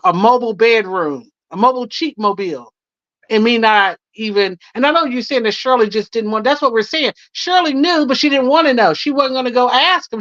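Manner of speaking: normal talking voice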